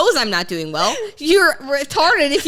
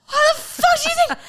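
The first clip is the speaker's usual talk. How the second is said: high mock outrage. Friend asks how she is, she squeals back in mock outrage. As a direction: pitch jumps way up in mock outrage. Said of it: "I'm almost, like, more angry that you asked".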